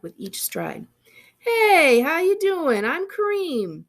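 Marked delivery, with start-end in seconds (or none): 1.4-3.8 higher and louder, easygoing call; a slow, loud, friendly hello; loud breezy greeting. Acted. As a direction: call out hello across the office. Kareem calls out breezy greeting.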